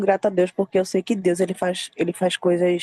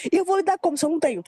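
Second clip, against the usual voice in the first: flustered protest; 9 semitones higher, much faster than usual. Retold or imitated. She protests she can't repay.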